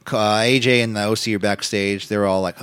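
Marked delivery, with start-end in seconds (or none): none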